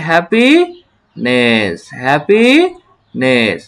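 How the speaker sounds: "Happiness" very slow, rising then falling; "happiness" drawn out in two pieces; broken into syllables. Shown as a demonstration. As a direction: stretch "happy" upward, pause, drop "নেস". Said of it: "একই রকম করে".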